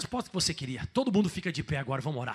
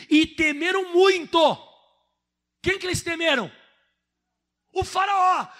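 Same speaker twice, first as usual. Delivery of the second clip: emphatic; 11 semitones higher, 11 dB louder than usual. Real, not acted. Preacher stresses the fear.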